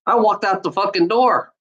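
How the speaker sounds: ordinary delivery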